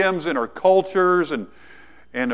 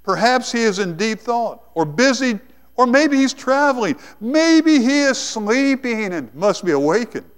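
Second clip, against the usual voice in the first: taunting; 6 semitones higher than usual. Acted. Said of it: "Elijah began to taunt them".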